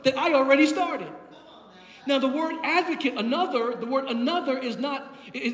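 preaching. Real, not acted. Preacher declares Jesus's words to congregation, preaching. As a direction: preach it out with punched key words.

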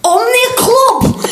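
very high, drawn-out thick-accent impression; Ashley Warbridge's thick accent. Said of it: "He went".

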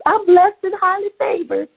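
high, bright fake-happy church answer; put-on cheerful mask. Preacher voicing her old self answering a greeting, high fake cheer. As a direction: hide the hurt behind a chirpy reply. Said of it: "so that's my mask".

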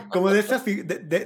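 ordinary delivery

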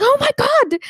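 high, loud gasp of alarm; shocked mum. Retold or imitated. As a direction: mum horrified at the wound. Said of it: "She was like".

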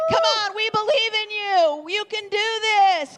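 mock crowd chant, bouncy and high; cheering crowd. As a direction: chant it like fans egging on a player. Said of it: "They were going".

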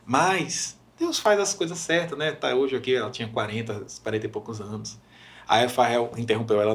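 usual speaking voice

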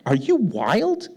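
high, swooping incredulous questions; mock parental disbelief. Dad finds daughter cutting her blanket with scissors, mock disbelief. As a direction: act the flabbergasted parent, pitch leaping up.

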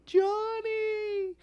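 high frantic call of a name; mother's relieved shout; mom calling out. Retold or imitated. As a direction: call out for your lost child, way up high. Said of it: "and she's like".

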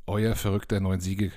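ordinary talk

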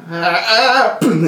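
high startled yelp. Acted. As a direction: yelp in alarm. NPC yelps before fleeing.

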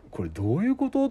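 high, puzzled self-question; wide-eyed confusion at the self-checkout; amazed at the self-checkout, a touch of surprise; surprised. Retold or imitated. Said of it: "と思って".

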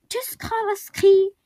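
high, loud, slow farewell call. A narrator's reading. Little bear calls goodbye to his fear, loud and high. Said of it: "rief der kleine Bär".

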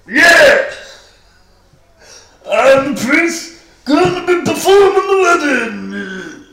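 high, very loud character voice; over-the-top shouted officiant; eccentric shouting priest. Acted. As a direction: bellow the introduction in a strained high voice. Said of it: "That's certainly unique, I must say".

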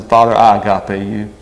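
ordinary talk

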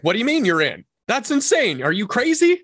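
high, amazed mock shock; retold disbelief. Founder retells reacting to a new partner, high mock disbelief. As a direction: act stunned that someone joins. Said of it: "I'm like".

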